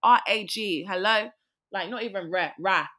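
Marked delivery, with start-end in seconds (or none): 0.0-1.3 loud, indignant correction; loud mock outrage. Real, not acted. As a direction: call it out loudly, half joking.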